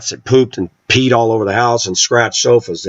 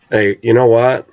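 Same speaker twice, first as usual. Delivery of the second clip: normal talking voice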